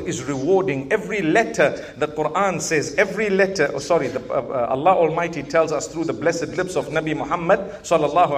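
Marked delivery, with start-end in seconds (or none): none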